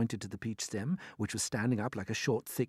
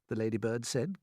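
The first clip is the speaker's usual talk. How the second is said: ordinary delivery, nothing marked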